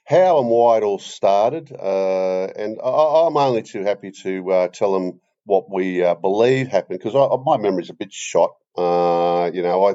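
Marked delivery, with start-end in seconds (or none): none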